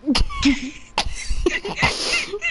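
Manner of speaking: high squealing laugh; real high-pitched laugh. Real, not acted. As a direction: burst out squealing. Bursting out laughing.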